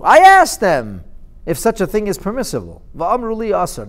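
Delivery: loud, high, incredulous king; sarcastic king calling their bluff; mocking king. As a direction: scoff at them like a king who knows better. King mocks the prophets.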